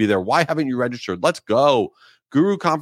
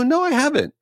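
much higher, chirpy reply; mock innocent answer; 14 semitones higher than usual. Answering the waiter at dinner, high chirpy reply. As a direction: chirp it like an eager diner.

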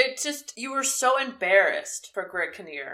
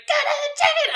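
very high, fast shouted rant; hammed-up screechy grandpa tirade; imitating grandpa losing it; 4 dB louder, faster than usual. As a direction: rattle it off in an outraged yell. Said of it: "He just, like, loses it".

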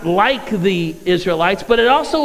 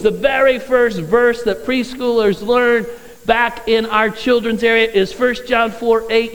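ordinary talk, nothing marked

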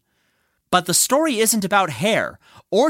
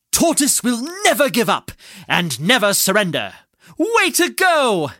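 rousing cheer; 4 semitones higher than usual. Acted. Listener cheers Tortoise toward the finish, excited rousing shout. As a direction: cheer the underdog on, rising to a long high whoop.

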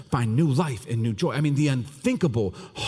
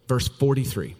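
ordinary delivery

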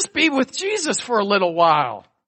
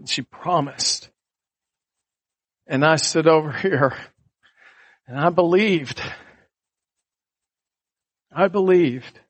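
ordinary delivery

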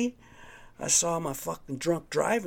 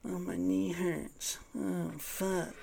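higher, slow, put-on whine of a sore knee; mock whiny passenger; 6 semitones higher, 4 dB quieter, much slower than usual. Mocking the woman in the car, slow high whine. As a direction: mimic her moaning about her knee, slowly and high.

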